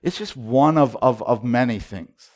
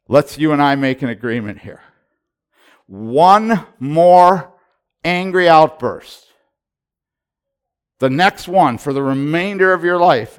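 raised, louder, emphatic warning; God giving a last warning, louder; stern divine ultimatum; 4 semitones higher, 9 dB louder than usual. Retold or imitated. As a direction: lay down the terms firmly, rising in force. God's ultimatum voiced in retelling.

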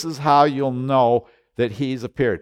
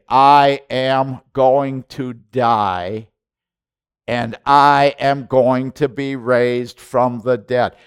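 louder, very slow, every word hammered; forceful insistence; 3 semitones higher, 8 dB louder, much slower than usual. Retold or imitated. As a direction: drive it home word by word, like grabbing him by the beard. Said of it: "grabbed him by the beard and say".

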